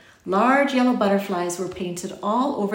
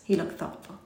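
ordinary delivery, nothing marked

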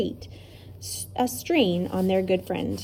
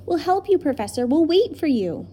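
put-on urging children; 6 semitones higher, 3 dB louder than usual.

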